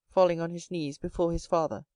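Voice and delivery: plain reading voice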